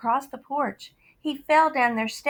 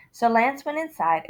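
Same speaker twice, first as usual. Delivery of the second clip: plain reading voice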